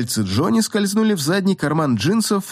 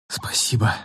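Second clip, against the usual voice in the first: low, rasping breathless whisper; 6 semitones lower, 4 dB quieter than usual. A narrator's reading. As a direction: rasp it out with no breath left. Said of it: "просипела девушка".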